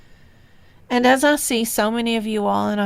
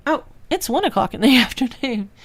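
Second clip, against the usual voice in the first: laughing at herself; 3 semitones higher, 5 dB louder, faster than usual. Real, not acted. Corrects herself, laughs at slip.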